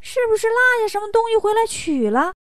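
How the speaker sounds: high, squeaky-small minion voice; little demon underling. Acted. Little demon offers the chief a guess, high-pitched. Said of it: "小妖说".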